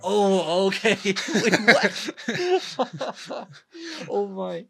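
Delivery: high, loud laughing disbelief; laughing shock. Real, not acted.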